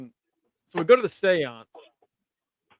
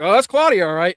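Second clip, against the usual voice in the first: amused exclamation; 3 semitones higher, 7 dB louder than usual. Retold or imitated. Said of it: "we heard that, like".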